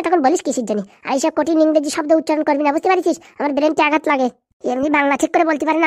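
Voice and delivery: ordinary delivery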